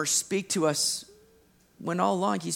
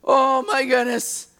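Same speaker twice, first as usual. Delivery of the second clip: gushing Peter; 7 semitones higher, 5 dB louder than usual. Retold or imitated. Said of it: "And Peter's just like".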